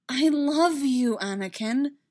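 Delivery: "I love you" slow, high and loud; emphatic. A narrator's reading. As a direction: stress "I love you", drawing it out. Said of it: "squeezing his hands for emphasis".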